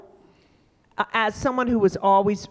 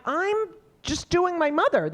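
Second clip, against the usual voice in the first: pitched way up in exasperated disbelief; exasperated protest; 11 semitones higher than usual. Retold or imitated. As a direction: defend yourself, voice leaping high. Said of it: "And I'm like".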